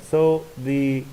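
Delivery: normal talking voice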